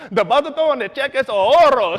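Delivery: loud, high Spanish recital; showing off. Retold or imitated. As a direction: belt out the memorised phrase with pride. Shows off the deposit phrase.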